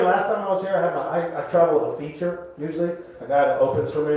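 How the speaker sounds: usual speaking voice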